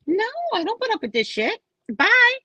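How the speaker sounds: high, fast mock refusal ending loud; hammed-up brush-off; high-pitched dismissal. Acted grandma sending child home.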